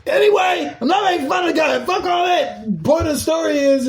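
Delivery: high-pitched, shouted brush-off; defensive outburst. Real, not acted. Brushing off possible offence.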